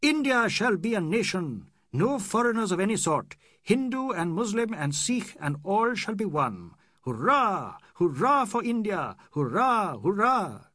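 high, loud patriotic shout; rally a crowd of one. Acted. Aziz shouting hurrahs for India, loud and high. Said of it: "Then he shouted".

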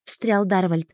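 usual speaking voice

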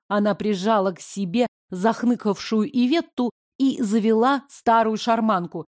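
usual speaking voice

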